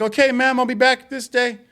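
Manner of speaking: high, put-on polite voice; polite worker voice. Cleaner answering the old lady, high mock-polite voice. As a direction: sound overly obliging to a customer. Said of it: "And you're like".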